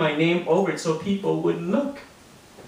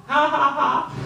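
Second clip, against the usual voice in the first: mock laugh; 10 semitones higher than usual. Kids laughing at the pun.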